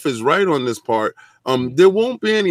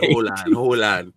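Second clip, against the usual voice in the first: deep voice; 8 semitones lower than usual. Pretend. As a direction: hold on in a very low voice. Repeated hold on very low.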